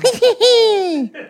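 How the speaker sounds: high-pitched put-on tittering laugh; high silly giggle. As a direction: giggle like a goofy cartoon. Silly sound montage in intro.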